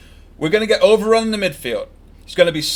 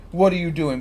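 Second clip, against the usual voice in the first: normal talking voice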